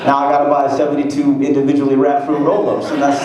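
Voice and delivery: normal talking voice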